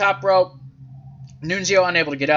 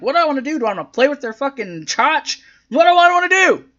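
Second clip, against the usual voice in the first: high-pitched mocking quote, rising to a loud finish; mock cluelessness; 8 semitones higher, 4 dB louder, faster than usual. Host mimics a wrestler unsure of her next move, mock clueless. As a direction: play up the helpless confusion, pitched up.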